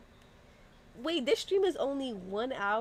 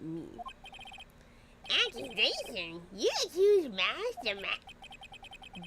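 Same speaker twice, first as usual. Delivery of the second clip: sneering villain voice; slower than usual. Acted. Voices defendant character.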